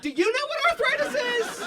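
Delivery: high, loud mocking throwback; laughing mock echo; mocking retort. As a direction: throw the question back mockingly.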